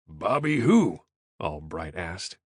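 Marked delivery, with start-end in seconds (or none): 0.1-1.0 befuddled. A narrator's reading. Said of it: "clearly befuddled".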